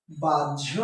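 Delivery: বাহ্য low and drawn apart, "baj-jho"; correct pronunciation. Shown as a demonstration. Teacher showing students the pronunciation, বাহ্য as baj-jho. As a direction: sound out বাহ্য as baj, then aspirated jho. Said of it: "তাহলে এটার উচ্চারণ হবে".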